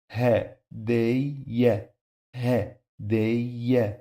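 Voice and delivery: Ha, day, ye as three separate beats; syllable by syllable. Shown as a demonstration. Teacher splitting the word for learners, syllable by syllable. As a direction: break it into Ha, day, ye with pauses. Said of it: "we have now three syllables".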